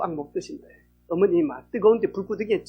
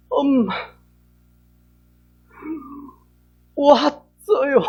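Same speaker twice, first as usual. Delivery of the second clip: slow, loud, higher tearful wail; crying; 6 semitones higher, 5 dB louder, much slower than usual. Retold or imitated. Sister arrives at mother's grave, loud slow crying. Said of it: "울기 시작하면서".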